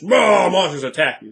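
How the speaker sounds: loud, drawn-out mock roar; mock monster attack. Game master mocking mindless combat encounters, loud and overblown. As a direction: blare it out like a crude ambush.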